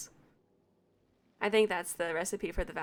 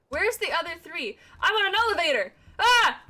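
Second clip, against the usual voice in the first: rising alarmed cries, louder and higher; startled yelp; 9 semitones higher, 6 dB louder than usual. Real, not acted. Gamer startled to find herself on an elevator, alarmed yelp. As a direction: caught off guard, yelp it out.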